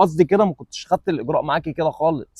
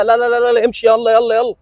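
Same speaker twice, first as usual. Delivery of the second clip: dismissive shooing; 6 semitones higher than usual. Acted. Shooing a woman off rudely.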